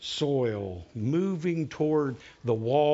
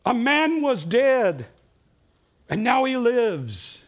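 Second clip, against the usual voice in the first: high, slow, louder announcement; messenger's proclamation; 7 semitones higher, 5 dB louder, slower than usual. Acted. Second messenger announcing the miracle at the wall, high and slow. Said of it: "And one says".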